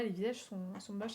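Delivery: ordinary delivery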